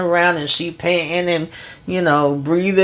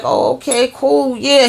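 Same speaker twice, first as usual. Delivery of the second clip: mock excited agreement; 7 semitones higher than usual. Recapping reality show, mocking excited agreement.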